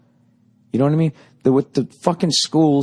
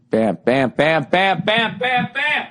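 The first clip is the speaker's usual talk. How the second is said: rising bam bam hammer noises climbing higher; imitated hammering; 8 semitones higher, slower than usual. Bam sounds climbing as he mimics the hammering dream.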